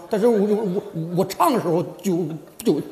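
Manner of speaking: halting stutter, getting stuck mid-phrase; stammering. Acted. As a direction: stammer and restart on each word. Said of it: "我说话不磕巴吗".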